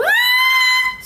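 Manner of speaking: sudden high piercing scream; shrieking scream. Shown as a demonstration. Vlogger shows how she loves to scream. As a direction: let out a wild high scream. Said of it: "eu adoro gritar".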